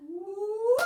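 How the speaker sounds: loud, high playful whoop. Acted. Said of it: "Can we do it again".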